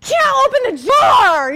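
loud, high, drawn-out exasperated cry; hammed-up wail at a stuck jar; frustrated outburst. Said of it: "I'm just frustrated at that point".

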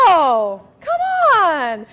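drawn-out, falling "no" and "come on"; emphatic refusal. Real, not acted. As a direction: wail it in playful protest. Comic refusal to the audience.

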